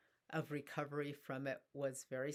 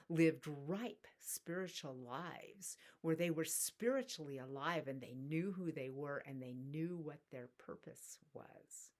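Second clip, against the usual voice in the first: ordinary talk, nothing marked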